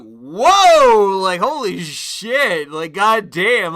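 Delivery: loud, slow "Whoa, holy shit, goddamn"; stunned whoa. Retold or imitated. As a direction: drag it out, floored by what you see. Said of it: "caught me so by surprise".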